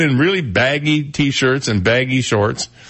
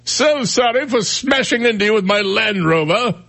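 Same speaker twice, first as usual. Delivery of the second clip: much higher, hammed-up British accent; mock British accent; 11 semitones higher than usual. Host teasing a British superhero to cohost, mock British accent. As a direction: overdo a polite British apology. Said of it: "all British guys sound the same".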